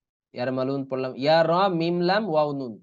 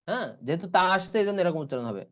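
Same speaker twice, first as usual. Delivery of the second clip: ordinary delivery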